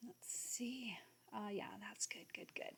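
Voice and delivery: whispered to herself while adjusting; hushed approval to herself. Real, not acted. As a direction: breathe it out under your breath. Muttering while nudging a letter.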